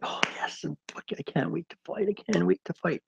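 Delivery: high, eager and breathless, can't wait; psyched up. Retold or imitated. Boxer hyped before his bout, excitedly high. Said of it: "So psyched up".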